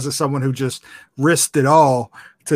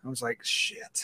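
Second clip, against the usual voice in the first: whispered curse; 3 semitones lower, 15 dB quieter than usual. Retold or imitated. Retold moment of panic.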